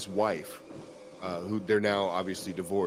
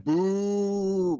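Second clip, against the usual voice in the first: mock boo; 10 semitones higher, 4 dB louder than usual. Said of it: "Peach was in the chat".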